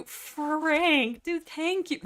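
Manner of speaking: high-pitched, gushing thank-you; excited thanks. Real, not acted. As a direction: thank a fan in a delighted high voice. Thanking a supportive viewer excitedly.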